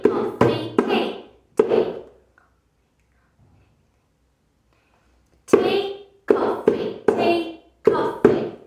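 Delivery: loud, crisp voiceless rhythm for copying; voiceless rhythm call. Shown as a demonstration. Teacher plays copycat with children, crisp and voiceless. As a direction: bark out each rhythm to be copied. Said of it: "Copy me".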